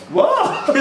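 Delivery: high startled what breaking into laughter; surprised laughing what. Real, not acted. Quizmaster startled by a player's interruption, laughing what. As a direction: caught off guard, laugh it out.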